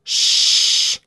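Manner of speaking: loud, long unvoiced shush; shushing hiss. A narrator's reading. Loud shush. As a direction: hush a crying child with a hiss. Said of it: "Vilma suhisee".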